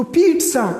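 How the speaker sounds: پیٹزا as "peet-sa"; correct pronunciation. Shown as a demonstration. Teacher recaps for learners, پیٹزا as "peet-sa", clear model pronunciation. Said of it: "اس کی صحیح تلفظ کیا ہے؟".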